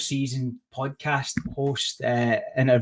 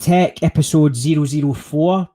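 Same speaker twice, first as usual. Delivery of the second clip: bright, lifted announcer opening; show intro; 3 semitones higher, 5 dB louder than usual. Acted. Host opening his podcast, announcer voice.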